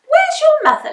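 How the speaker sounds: high, loud questioning witch voice; coaxing the little dragon to answer; witch asking. A narrator's reading. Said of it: "Winnie asked".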